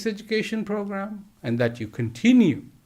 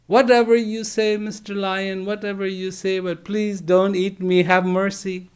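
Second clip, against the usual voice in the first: frantic pleading cub; 6 semitones higher than usual. A narrator's reading. Cub keeps begging the big lion.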